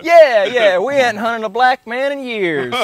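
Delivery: loud, high, hammed-up old-timer excitement; mock gleeful whoop. Joking as an old-days Georgia posse man, loud mock glee. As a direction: holler it with put-on relish.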